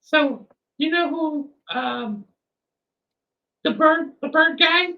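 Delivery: a flustered, eager-to-please character; nervous NPC voice. Acted.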